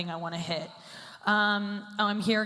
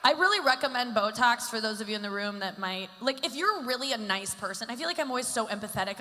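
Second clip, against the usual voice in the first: ordinary delivery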